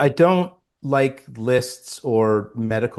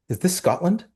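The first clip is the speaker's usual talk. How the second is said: eager; 9 semitones higher than usual. Retold or imitated. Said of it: "I said".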